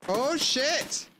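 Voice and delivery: sudden high startled shout; startled curse. Real, not acted. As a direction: caught off guard, blurt it out. Raiders appear unexpectedly.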